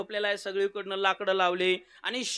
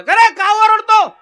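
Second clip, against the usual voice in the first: higher, louder, slow stern god's demand; Yama scolding; 13 semitones higher, 14 dB louder, slower than usual. Acted. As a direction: bark the question down at him. Yama questions the shouting man.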